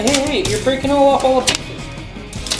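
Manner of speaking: ordinary delivery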